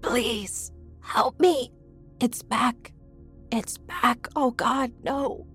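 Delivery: high, breathy panic; terrified pleading. A narrator's reading. Mother thrashing in her nightmare, terrified pleading. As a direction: beg in high, frightened bursts.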